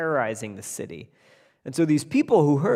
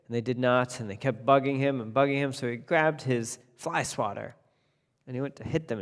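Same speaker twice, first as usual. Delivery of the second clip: ordinary delivery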